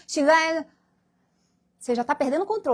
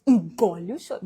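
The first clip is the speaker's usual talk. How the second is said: stern parent; 6 semitones lower than usual. Retold or imitated. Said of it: "eu chorava".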